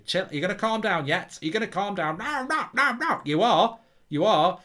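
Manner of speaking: high, insistent mock telling-off; rising, forceful play-scolding of his younger self; acted scolding. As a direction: act out restraining someone who resists. Acting out restraining his younger self.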